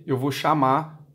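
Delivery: usual speaking voice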